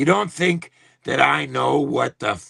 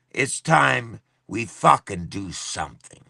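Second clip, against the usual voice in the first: ordinary talk, nothing marked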